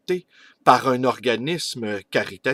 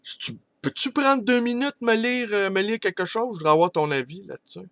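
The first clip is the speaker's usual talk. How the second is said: much higher-pitched pleading request; eager clown asking; 9 semitones higher, faster than usual. Acted. Clown asking Yogourt to read his work, high-pitched request. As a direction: pitch it high, rushing at the end. Clown asks another for an opinion.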